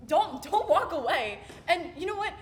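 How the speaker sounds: indignant, emphatic rebuke with swooping pitch; hurt confrontation. Acted. Confronting someone who says sorry, hurt and indignant. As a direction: stop them leaving, stressing each refusal.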